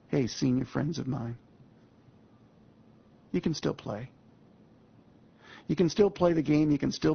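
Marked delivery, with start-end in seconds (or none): none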